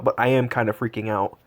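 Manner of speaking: usual speaking voice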